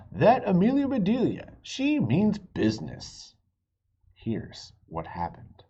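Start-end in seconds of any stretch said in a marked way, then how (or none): 0.1-3.3 lightly voiced mayor, higher and louder on the name; a hint of a town mayor declaring it; the mayor's verdict. Narrator voicing the mayor's proclamation about Amelia, mildly hammed-up. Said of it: "the mayor ... finally said".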